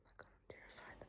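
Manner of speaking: ordinary talk, nothing marked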